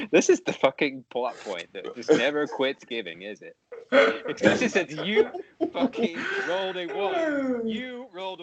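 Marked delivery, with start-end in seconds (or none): 4.3-8.4 cracking up mid-sentence; laughing. Real, not acted. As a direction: laugh through the words.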